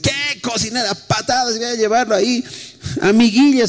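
a lightly voiced stern angry father; harsh father. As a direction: scoff like a fed-up father. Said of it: "algunos papás deben decir".

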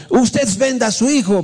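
imitating an annoyed heckler, pitched high; high indignant yell, without real volume; mock angry shout. Congregant calling back at the pastor's question, mock angry shout. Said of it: "Así me gritó un".

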